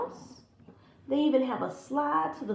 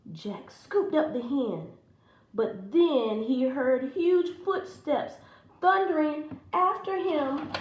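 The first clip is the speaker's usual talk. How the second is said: ordinary delivery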